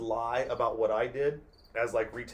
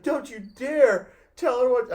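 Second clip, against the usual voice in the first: much higher, louder, slow mock yell; imitated yelling mom; 12 semitones higher, 11 dB louder, slower than usual. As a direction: shriek it like a furious mom. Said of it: "my mom starts yelling at me".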